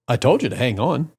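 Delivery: even, unbothered retold reply; calm. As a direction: drop back to a cool, level voice. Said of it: "he just calmly goes".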